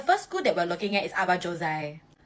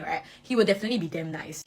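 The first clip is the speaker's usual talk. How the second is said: ordinary talk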